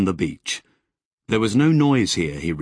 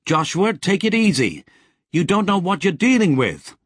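higher, louder warning call; 9 semitones higher, 4 dB louder than usual. A narrator's reading. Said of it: "Sally called".

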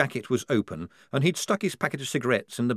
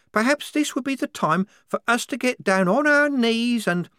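put-on higher, louder voice for a devout girl soldier; a zealous young recruit, fervent and sure; higher fervent believer voice; 11 semitones higher, 5 dB louder than usual. A narrator's reading. Wazza voiced, devout conviction.

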